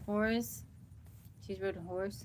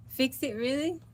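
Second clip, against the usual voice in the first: son's quote; 7 semitones higher, 9 dB louder than usual. Retold or imitated. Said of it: "my son brought his Dremel and said".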